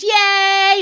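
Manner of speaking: mock cheer, high and loud; imitated excited cheer. Explaining overexcitement to viewers, imitated gleeful cheer. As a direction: voice an overexcited stomach cheering "yay". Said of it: "my stomach goes".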